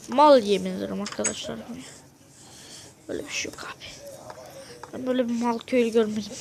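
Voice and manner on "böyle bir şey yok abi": soft unvoiced whisper of disbelief; whispered aside. Real, not acted. Gamer telling viewers the glitch is unbelievable, whispered aside. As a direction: breathe it to the viewers like a secret.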